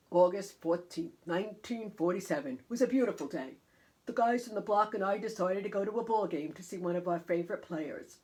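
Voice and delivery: storytelling uncle voice; Uncle Frankie storytelling. Acted. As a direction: spin a tale of an old ball game. Uncle Frankie tells his story.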